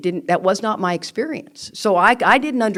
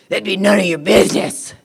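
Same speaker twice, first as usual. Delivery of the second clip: gruff businessman put-down; 6 dB louder than usual. Retold or imitated. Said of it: "turned his big old meathead to me and goes".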